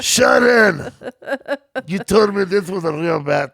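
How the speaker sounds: high wailing call to his wife. Acted. Co-host as the rocker blaming his wife, wailing. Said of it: "if [name] would have shot someone".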